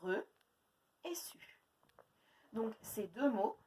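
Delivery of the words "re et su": re, then su, set apart; split into syllables. Shown as a demonstration. Teacher to pupils, splitting the word into syllables. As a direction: split reçu into its two syllables. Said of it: "deux syllabes".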